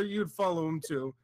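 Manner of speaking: ordinary talk, nothing marked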